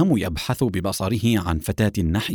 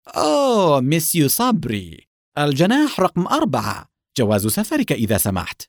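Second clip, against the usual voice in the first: high-pitched delighted greeting, easing to usual; welcoming exclamation; 5 semitones higher than usual. A narrator's reading. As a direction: greet the guest high, then settle into business. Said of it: "صاح بلهجة مرحبة". (بلهجة مرحّبة)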